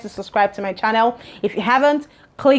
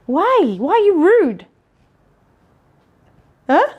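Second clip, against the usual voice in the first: exasperated scolding; 7 semitones higher, 3 dB louder, slower than usual. Real, not acted. Scolding viewers for rudeness.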